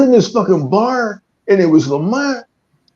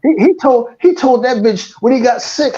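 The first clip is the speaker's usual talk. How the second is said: ordinary delivery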